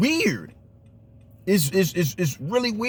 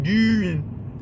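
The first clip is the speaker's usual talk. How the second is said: Dude in a mock Baltimore accent, a touch higher; 5 semitones higher than usual. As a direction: toss off Dude like a Baltimore local. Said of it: "they already talk like".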